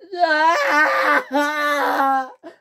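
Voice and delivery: high loud wailing sob; hysterical crying. Acted. James crying hysterically in the field. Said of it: "started crying hysterically".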